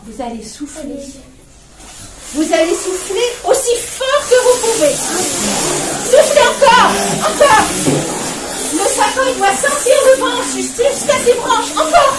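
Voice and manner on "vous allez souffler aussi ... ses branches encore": loud and high, egging on; excited urging. Acted. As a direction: pump up the crowd. Urging children to blow.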